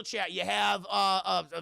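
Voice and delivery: ordinary delivery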